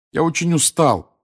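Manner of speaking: tired, imploring; pleading. A narrator's reading. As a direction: beg to be let off. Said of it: "взмолился через полчаса Гарри".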